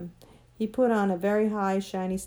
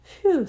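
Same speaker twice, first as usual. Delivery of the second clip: high, loud phew of relief; relieved phew; 9 semitones higher, 8 dB louder than usual. A narrator's reading. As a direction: let out a big breath of relief. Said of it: "letting out a long breath of relief".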